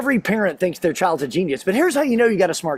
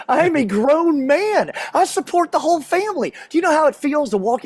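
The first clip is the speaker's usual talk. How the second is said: higher, stretched-out protest; wounded pride, hammed up in a raised pitch; mock indignation; 6 semitones higher than usual. Husband protesting he is emasculated, mock indignant.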